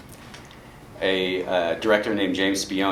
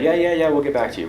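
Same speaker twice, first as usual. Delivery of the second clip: higher, rushed mock brush-off; dismissive brush-off; 7 semitones higher, 3 dB louder, faster than usual. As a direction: imitate someone quickly fobbing off a caller. Brush-off from stations he called.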